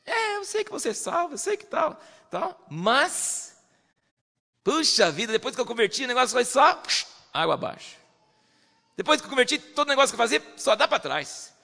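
high, whiny mock complaint; complaining believer. Preacher mocks a believer who complains about losses, high and whiny. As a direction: mimic a grumbling churchgoer. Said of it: "tem crente que fica assim".

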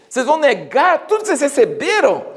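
high-pitched acted reproach; retold apostolic scolding, pitched high; indignant challenge. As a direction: challenge them in exasperated disbelief. Paul challenges the Galatians.

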